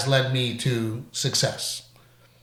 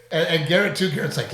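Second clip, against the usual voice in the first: usual speaking voice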